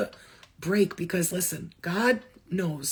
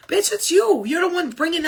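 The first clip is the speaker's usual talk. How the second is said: much higher, louder callout; angry outburst; 8 semitones higher, 6 dB louder than usual. Real, not acted. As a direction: jab it straight at them. Calling out fake healers.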